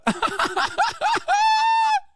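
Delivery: sudden high cackling laugh; loud burst of laughter. Real, not acted. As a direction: laugh out loud at the absurd line. Laughs at clip's claim.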